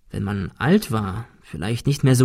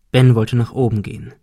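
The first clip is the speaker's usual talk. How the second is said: ordinary delivery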